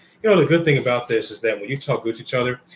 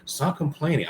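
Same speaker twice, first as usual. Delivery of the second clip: mock disbelief; 6 semitones higher than usual. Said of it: "I'm like".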